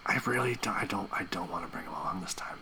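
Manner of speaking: hushed aside to fiance; whisper. Real, not acted. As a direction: keep it secret, whisper. Private chat with fiance.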